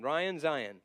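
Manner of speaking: "Zion" said to rhyme with "Ryan"; "Ryan, Zion" as a rhyme pair; rhyming pronunciation. Shown as a demonstration. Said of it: "Zion rhymes with Ryan".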